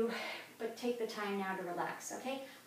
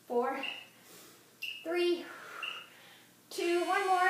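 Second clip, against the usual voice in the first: loud countdown; 7 semitones higher, 6 dB louder, slower than usual. Real, not acted. Coach counting down final reps.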